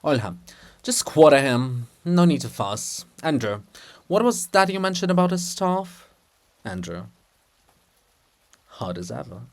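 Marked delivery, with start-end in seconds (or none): none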